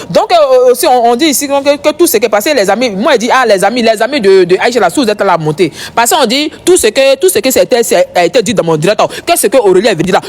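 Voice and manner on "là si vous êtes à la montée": ordinary talk, nothing marked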